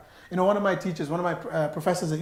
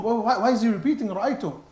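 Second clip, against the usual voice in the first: ordinary talk, nothing marked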